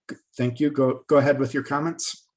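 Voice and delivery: ordinary talk, nothing marked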